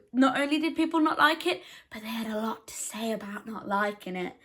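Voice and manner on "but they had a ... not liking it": unvoiced, hushed aside; whispered aside. Real, not acted. Vlogger whispering an aside to viewers about reviewers. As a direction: drop to a whisper to the camera.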